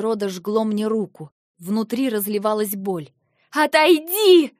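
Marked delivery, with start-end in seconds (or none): 3.5-4.5 high, loud, slow yell. Acted. Eva in pain driving Lentar away, yelling. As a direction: scream it at him. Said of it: "Я уже орала".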